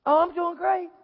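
put-on cheerful. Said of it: "put that on".

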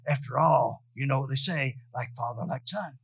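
devil's taunt. Retold or imitated. Devil's whispered taunt in story.

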